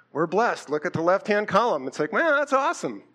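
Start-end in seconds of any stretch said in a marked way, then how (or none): 2.1-3.0 higher-pitched, impressed exclamation; acted wow-that's-great reaction. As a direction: marvel at good news. Acts out delighted reaction to blessings.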